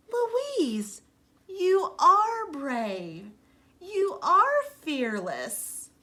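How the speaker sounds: high, very slow heartfelt praise; admiring praise. Acted. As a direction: tell her slowly she's brave. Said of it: "Sheila Ray said".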